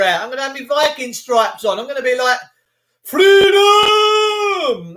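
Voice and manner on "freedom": high, loud drawn-out war cry; William Wallace battle cry. Pretend. Streamer imagining the rally, shouting like a rebel leader. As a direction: roar it like a rebel leading a charge. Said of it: "William Wallace".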